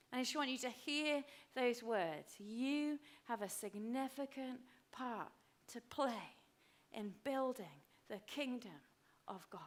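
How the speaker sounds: hushed, unhurried words with pauses; soft reverent address. Real, not acted. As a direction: speak gently to listeners with eyes closed. Congregation asked to close eyes.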